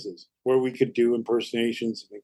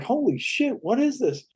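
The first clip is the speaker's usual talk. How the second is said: shocked; 9 semitones higher than usual. Retold or imitated. Shocked at ashen audience.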